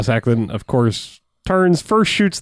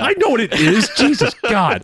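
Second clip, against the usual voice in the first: much higher, hammed-up exasperation; exasperated outburst; 9 semitones higher than usual. FBI head reacts to being lectured, mock exasperation. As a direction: cut off an explanation, comically fed up. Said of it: "the best reaction".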